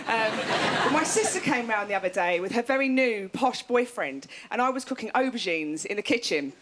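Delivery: normal talking voice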